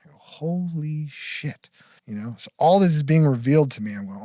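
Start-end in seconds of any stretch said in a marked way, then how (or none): 0.2-1.7 slow, hushed amazement; drawn-out, softer disbelief; retold awe. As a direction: stretch both words out softly in wonder. Retells amazement at the revelation.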